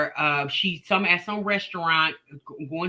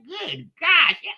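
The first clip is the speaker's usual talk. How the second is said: laughing exclamation; 9 semitones higher, 4 dB louder, slower than usual. Real, not acted. Reacting to a chat comment.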